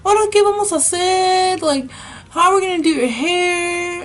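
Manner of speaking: high mother voice in Spanish; exasperated mom. Retold or imitated. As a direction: fret over what to do with the hair. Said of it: "my mom's like".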